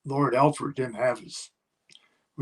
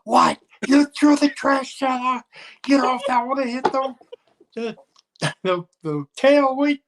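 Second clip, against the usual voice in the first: high, loud, disbelieving mock tirade; a retold pilot yelling at a man who threw out the trash can; furious pilot; 13 semitones higher, 7 dB louder than usual. Pilot scolding over trash can.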